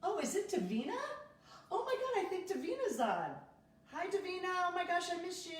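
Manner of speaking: high-pitched, delighted surprise; excited greeting. Real, not acted. Spotting Davina joining the live video, excited greeting. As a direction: squeal hello to a friend you have missed. Spots a missed friend joining.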